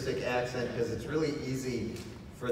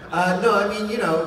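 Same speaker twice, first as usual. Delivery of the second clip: laughing; 10 semitones higher, 12 dB louder than usual. Real, not acted. Laughs at being asked.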